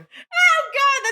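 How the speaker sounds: high, loud oh God; disgusted shock. Real, not acted. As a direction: recoil in disgust. Reaction to gross tooth story.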